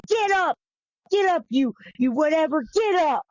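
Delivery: high, harsh mock shout of soldiers; mother can hear the soldiers yelling; retold soldiers' yell. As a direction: bark at him to get up like a cruel guard. Soldiers yelling at fallen Jesus.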